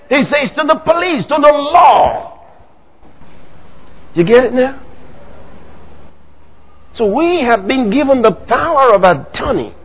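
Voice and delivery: usual speaking voice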